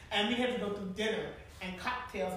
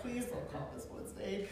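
softer pleading under the breath; silent plea; 6 dB quieter than usual. Retold or imitated. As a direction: pray quietly she won't say it. Silent prayer watching first lady.

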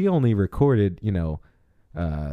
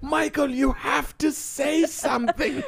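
high-pitched pleading doctor; Doctor Loomis voice. Retold or imitated. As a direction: beg him like an exasperated doctor. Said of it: "Doctor Loomis suddenly made an appearance".

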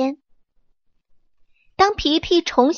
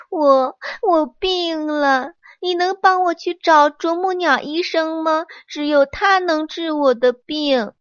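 pitiful pleading; 6 semitones higher than usual. Acted. Sick peach tree begging the monkey to fetch the doctor, pitiful. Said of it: "桃树可怜巴巴地哀求皮皮".

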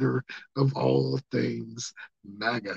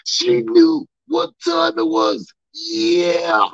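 much higher, louder and slow, hitting "knew"; high yelled promo line; 6 dB louder, much slower than usual. Acted. Repeating the line to his audience as a high, hammed-up yell.

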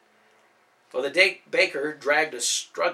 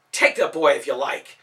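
louder, huffy concession; indignant; 8 dB louder than usual. Acted. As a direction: give in with wounded pride. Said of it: "the baker said indignantly".

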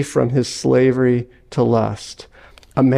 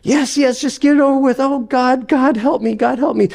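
higher, despairing ghost begging; desperate ghost; 8 semitones higher than usual. Acted. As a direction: give in and pray in terror.

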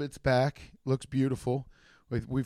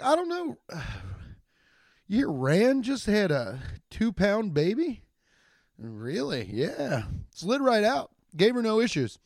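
gossipy quote; 7 semitones higher than usual. Retold or imitated. Acted gossip between two people.

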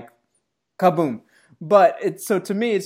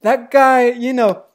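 put-on louder, higher brash Irish accent; mock Irish accent, pitched up and loud; Conor McGregor Irish accent; 6 semitones higher, 4 dB louder than usual. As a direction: swagger it out in an Irish fighter's accent. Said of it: "in the voice of Conor McGregor".

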